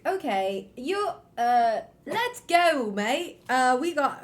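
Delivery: shaky attempt at British, searching for words; British accent. Shown as a demonstration. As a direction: put on a halting British accent.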